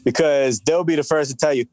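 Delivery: ordinary talk, nothing marked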